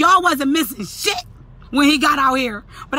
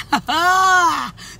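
gloating cackle; 5 semitones higher than usual. Real, not acted. Wife laughing at the rival turned away, gloating cackle.

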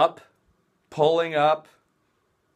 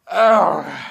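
frustrated groan; 4 semitones higher, 10 dB louder than usual. Real, not acted. Groan at upload time.

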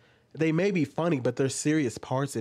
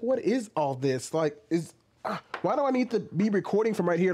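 usual speaking voice

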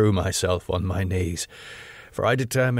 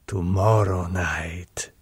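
low whispered repeat; Count's whispered promise to the women; whispered echo; 4 semitones lower, slower than usual. Acted. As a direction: breathe it as a secret promise.

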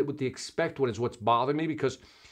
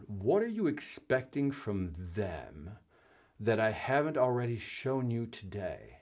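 slower and softer, weighing each word; slow musing reread; 3 semitones lower, 7 dB quieter, slower than usual. Shown as a demonstration. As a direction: savour the question, quietly and slowly. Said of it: "Oh, that's a nice one".

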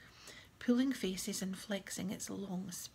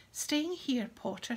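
raised, higher-pitched jeer; taunting shout; 4 semitones higher, 6 dB louder, slower than usual. A narrator's reading. Bully calling to boy left behind, taunting shout. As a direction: call it out to mock him. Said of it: "shouted Malfoy".

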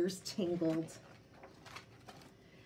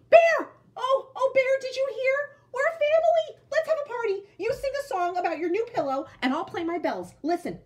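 very high, loud, squealing with joy; bursting with excitement, fast; overexcited cry; 10 dB louder than usual. Acted. Jack the jack-in-the-box squealing to Bear about family. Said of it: "Jack cried".

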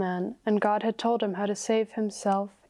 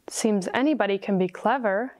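plain reading voice

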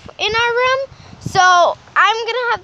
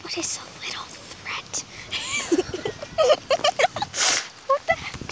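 whispering; 7 semitones higher, 10 dB quieter than usual. Pretend. Hiding, giggling at granny.

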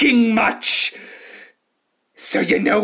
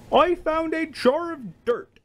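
usual speaking voice

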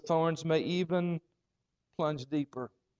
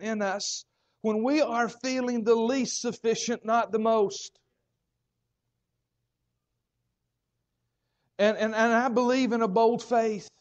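fervent preaching; 7 semitones higher than usual. Acted. Preaching at full force.

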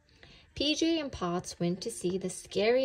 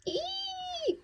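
piercing, very high wake-up shriek; high scream. A narrator's reading. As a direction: shriek as if out of a nightmare. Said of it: "screamed P J".

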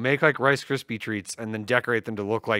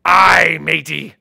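pirate voice; 4 semitones higher, 11 dB louder, much slower than usual. Retold or imitated. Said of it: "What did the pirate say".

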